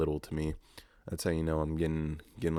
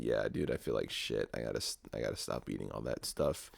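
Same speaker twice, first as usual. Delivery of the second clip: low grumbling to himself; 7 semitones lower, 6 dB quieter than usual. Retold or imitated. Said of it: "was like".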